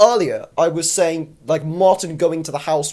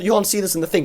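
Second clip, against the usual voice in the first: usual speaking voice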